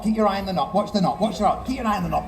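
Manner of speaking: ordinary talk, nothing marked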